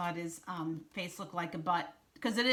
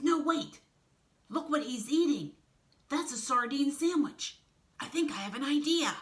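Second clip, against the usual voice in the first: louder, higher excited character voice; eager Piranha, scheming; 7 semitones higher, 6 dB louder than usual. Acted. Piranha stops the others with a new idea, eager. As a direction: pipe up loudly with a sudden idea.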